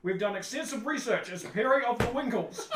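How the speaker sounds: loud, high indignant mock yell; yelling back. Heckler corrects the announcer on a name, yelling back. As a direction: holler it back at the stage. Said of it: "someone yells back".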